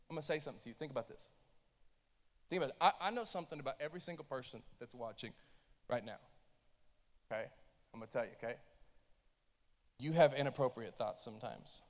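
hushed confession to the congregation; confiding. Real, not acted. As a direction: lean in and tell them quietly. Preacher drops to confiding tone.

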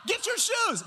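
high, loud panicked yelling; panicked shout. Retold or imitated. Pastor shouting wrong words at brother-in-law in panic. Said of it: "so I'm yelling".

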